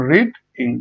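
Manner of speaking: রিড, ইং as two separate beats; split into syllables. Shown as a demonstration. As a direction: break after রিড, then say ইং. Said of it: "এটি দুটি syllable".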